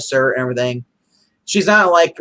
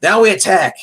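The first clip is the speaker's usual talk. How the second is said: mock villain command; 6 semitones higher, 6 dB louder than usual. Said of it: "He's like".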